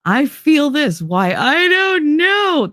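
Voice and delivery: high, drawn-out mock wail; mock whining generation. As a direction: imitate an overwrought youngster, stretching the last words up high. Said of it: "a whole generation of people screaming because".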